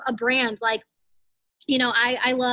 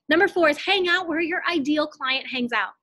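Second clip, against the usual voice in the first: ordinary talk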